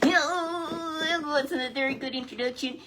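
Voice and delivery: high, slow puppet voice. Acted. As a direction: puppet sidekick comments on the scene.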